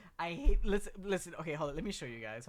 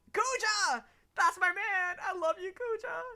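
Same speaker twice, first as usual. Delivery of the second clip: excited shout; 6 dB louder than usual. Real, not acted. Favourite card revealed.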